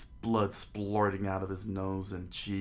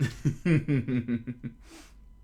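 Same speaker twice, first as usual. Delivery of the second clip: giggling through; loud laughter; laughing; 4 semitones higher, 6 dB louder than usual. Real, not acted. Laughs at own story.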